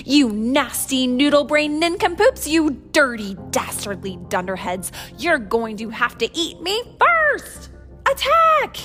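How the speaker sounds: loud storybook insults rising to a shout; defiant battle cry. A narrator's reading. Captain Flynn charging the pirate dinosaurs, defiant shouting. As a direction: hurl insults, building to a high yell.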